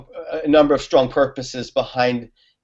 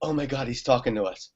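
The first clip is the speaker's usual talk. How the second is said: shocked hush; 2 semitones lower, 10 dB quieter than usual. Retold or imitated. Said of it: "and they're like".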